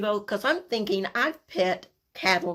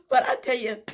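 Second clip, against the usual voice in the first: usual speaking voice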